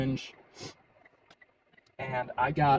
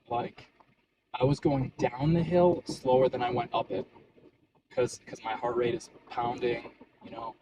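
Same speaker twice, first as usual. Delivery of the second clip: ordinary talk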